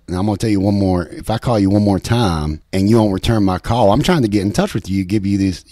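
mock-threatening West Virginia hillbilly, loud and fast; a joke voicemail to his friend, hammed-up hillbilly threats; threatening hillbilly accent. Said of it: "threatening voice messages in, in, like, a hillbilly, hillbilly accent".

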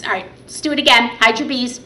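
normal talking voice

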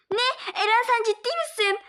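high startled disbelief; shocked. Acted. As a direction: gasp it out in shock. Shocked at news.

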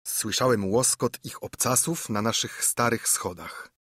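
usual speaking voice

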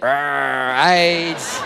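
low, growling mock aggression; aggressive growl. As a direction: growl it like a brute. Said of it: "the aggressive".

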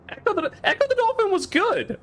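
very high-pitched, put-on voice; playful squeaky complaint; squeaky mock protest. Joking defence of Echo the Dolphin.

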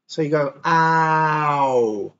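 "ow" drawn out slowly, mouth rounding; slow ow sound. Shown as a demonstration. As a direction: model the OW vowel slowly. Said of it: "The O W sound is an ow".

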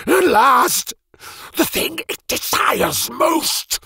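gleeful triumph. Acted. Mage holds the tixorix aloft, crowing with glee. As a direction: crow with childish delight. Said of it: "laughing and capering like a child".

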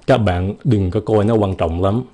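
ordinary talk

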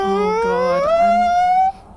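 shriek. Real, not acted. Friend's shrill fan voice.

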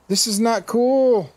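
high, loud and slow complaint; exasperated. Real, not acted. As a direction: drag it out, fed up. Losing items, overweight.

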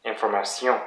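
"Information" in French, final syllable stressed; French pronunciation. Shown as a demonstration. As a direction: demonstrate French "Information" with a nasal final "-sion". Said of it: "word is the last syllable".

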